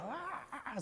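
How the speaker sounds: high, soft mock wail; faraway pretend tantrum cry; distant toddler scream. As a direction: imitate screaming muffled behind a closed door. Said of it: "se oye allá en el fondo".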